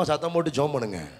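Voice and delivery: ordinary talk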